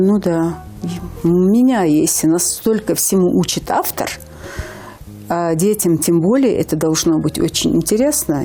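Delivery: ordinary talk, nothing marked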